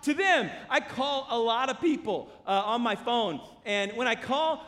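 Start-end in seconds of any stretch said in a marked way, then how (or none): 0.0-0.5 high, loud preaching shout; raised voice, driving each phrase home; forceful command. Acted. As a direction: order them away with urgency. Voices Jesus urging reconciliation forcefully.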